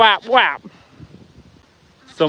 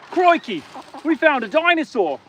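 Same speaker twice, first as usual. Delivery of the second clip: higher, hammed-up Australian croc-hunter exclamation; mock Australian wildlife host; 7 semitones higher than usual. Farmer joking about the chicken in a mock Australian wildlife-host voice. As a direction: play a mock Australian nature presenter.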